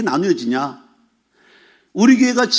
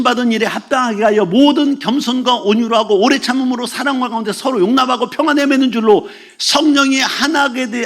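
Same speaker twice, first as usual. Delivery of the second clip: raised, sustained declamatory recital; scripture recitation; 4 semitones higher than usual. Acted. Pastor reciting Paul's charge to the church, declamatory scripture recitation. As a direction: proclaim the verse in one driving high line.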